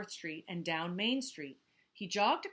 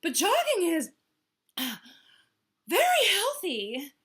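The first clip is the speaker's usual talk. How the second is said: gasping Hippo; 10 semitones higher, 9 dB louder than usual. Acted. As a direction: insist while out of breath. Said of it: "gasped Hippo".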